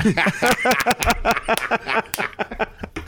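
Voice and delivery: long, high-pitched laugh; helpless run of high laughter. Real, not acted. Co-host cracking up over a movie-seat joke, laughing.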